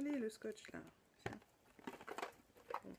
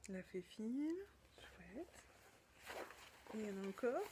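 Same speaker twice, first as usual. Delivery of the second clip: ordinary delivery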